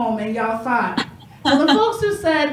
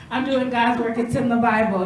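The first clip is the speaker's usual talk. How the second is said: ordinary talk, nothing marked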